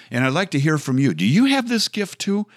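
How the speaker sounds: playful excitement. Acted. Host inviting listeners to share the gift, excited. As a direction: bubble over with mock enthusiasm.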